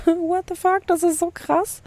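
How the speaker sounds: high laughing disbelief; laughing surprise. Real, not acted. Surprised by hundred listeners. (lachend überrascht)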